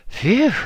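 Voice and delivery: big, high, loud relieved phew; hearty relief. Acted. Boy escaping the abbot's room, loud relieved phew. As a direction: blow out the relief after the ordeal. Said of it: "of hearty relief".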